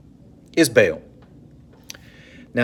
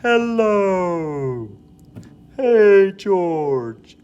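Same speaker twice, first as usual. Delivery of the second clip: high, drawn-out goofy greeting; sing-song silly hello to a friend; Seinfeld "the voice"; 8 semitones higher, 3 dB louder, much slower than usual. Retold or imitated. Friends greeting each other in the goofy voice. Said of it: "used to use the voice".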